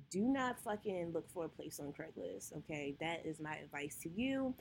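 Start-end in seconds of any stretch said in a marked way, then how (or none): none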